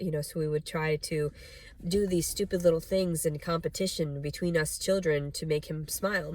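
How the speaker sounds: usual speaking voice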